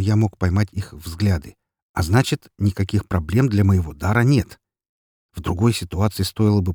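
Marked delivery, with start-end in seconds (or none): none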